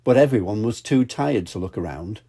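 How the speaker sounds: ordinary delivery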